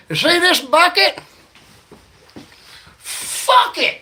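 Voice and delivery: high, loud hammed-up shout; mock drunken wrestling-ring yell; drunk comic's yell. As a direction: bellow the punchline at the crowd. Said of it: "He's like".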